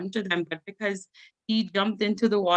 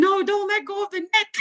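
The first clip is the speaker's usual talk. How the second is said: urgent warning; 13 semitones higher than usual. Retold or imitated. Grandpa warns during minnow catch.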